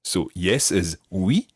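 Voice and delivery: slow, deliberate repeat; slow, clear teaching. Shown as a demonstration. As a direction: spell it out slowly for learners. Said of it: "repeat that after me".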